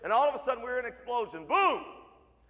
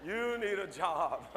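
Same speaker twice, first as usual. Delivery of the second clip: drawn out at half pace, slightly lower; slow retort; 4 semitones lower, 3 dB quieter, much slower than usual. Retold or imitated. Dad tells waiter off, slow retort. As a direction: stretch out each word of the put-down.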